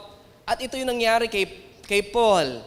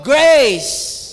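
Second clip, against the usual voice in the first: loud, higher "Grace" called to the congregation; call-out word; 4 semitones higher, 11 dB louder than usual. Shown as a demonstration. Pastor prompting the congregation to repeat, loud "Grace". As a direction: lead the crowd in shouting "Grace". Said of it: "Pakisabi nga po".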